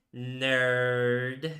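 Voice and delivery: sneering, mocking one-word jab; a snotty, dismissive sneer; snarky little voice. Shown as a demonstration. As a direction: spit it out like a snide insult. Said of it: "I could say it with a snarky little voice".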